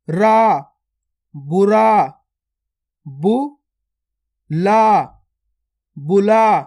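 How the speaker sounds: put-on teacher blending sounds for children; syllable drill. Shown as a demonstration. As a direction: split each word into loud separate syllables, then join them. Reads syllable drill aloud.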